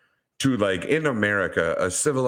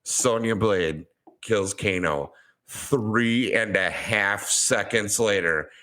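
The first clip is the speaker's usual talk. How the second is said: ordinary talk, nothing marked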